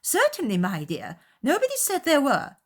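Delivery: high, loud defensive matron; huffy mother. A narrator's reading. Mother defending her neighbourhood, high and huffy. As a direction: dig in with wounded pride. Mrs. Bennet voiced.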